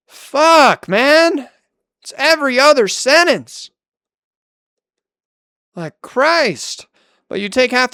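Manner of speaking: loud, high exasperated outburst; frustrated yelling. Real, not acted. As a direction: blow up at him in real frustration. Exasperated outburst during a relationship fight.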